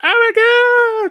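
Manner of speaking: high squealed mock gasp; hammed-up high shocked cry. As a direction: shriek it in fake horror. Mock shocked wrestling reaction.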